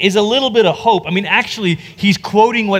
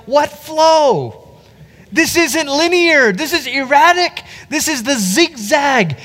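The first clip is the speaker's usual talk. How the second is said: bewildered reader; 9 semitones higher, slower than usual. Retold or imitated. Mock bewildered reader reaction.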